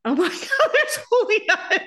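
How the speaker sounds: laughing, thrilled recognition of a friend on a plane; excited recognition. Retold or imitated. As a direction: spot an old friend and squeal with delight. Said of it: "I'm like".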